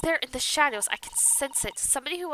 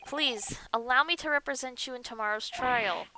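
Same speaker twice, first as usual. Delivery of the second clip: ordinary delivery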